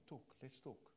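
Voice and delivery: soft, coaxing let's talk; gentle invitation. Pretend. God gently inviting the listener to talk, soft. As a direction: softly coax them to open up.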